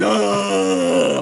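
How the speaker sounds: brief mock jungle call; a short Tarzan-style cry; Tarzan yell. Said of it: "like Tarzan".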